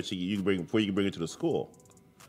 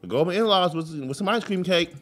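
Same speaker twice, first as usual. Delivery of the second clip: usual speaking voice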